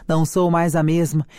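ordinary delivery, nothing marked

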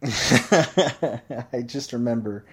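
slow, laughing through the words. Real, not acted. Picking the story back up, slowed by laughter. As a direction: still giggling as he restarts.

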